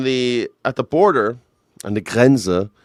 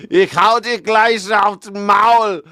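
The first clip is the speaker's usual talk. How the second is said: high, loud, drawn-out German threat; mock German threat; 8 semitones higher, 7 dB louder, much slower than usual. Host joking about blunt Germans, loud mock threat. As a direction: belt it out slowly as a playful threat.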